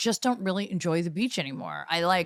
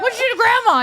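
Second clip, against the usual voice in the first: high, loud alarmed mom; shocked mom; 9 dB louder, faster than usual. Retold or imitated. Mom asks child why grandma is bleeding, high and loud. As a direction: play the horrified mom catching her kid.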